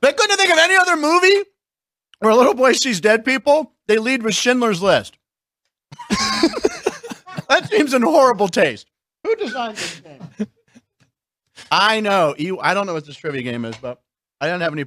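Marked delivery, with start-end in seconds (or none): 0.0-10.5 incredulous laughing. Real, not acted. Mocking the trivia card.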